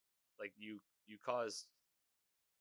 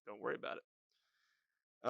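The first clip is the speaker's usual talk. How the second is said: slow, detached brush-off; cold dismissal; slower than usual. Retold or imitated. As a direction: wave the question away icily. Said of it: "So really cold stuff".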